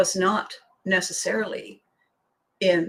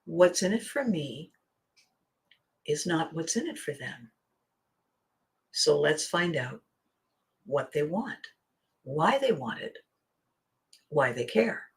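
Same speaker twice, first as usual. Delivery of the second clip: softer, lower, earnest close; sincere, quieter; 4 semitones lower, 6 dB quieter than usual. Real, not acted. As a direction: bring it home gently. Closing lesson, softer.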